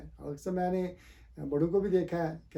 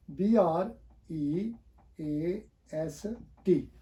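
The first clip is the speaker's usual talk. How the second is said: letters spelled out very slowly; slow spelling; much slower than usual. Shown as a demonstration. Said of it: "spells the word slowly".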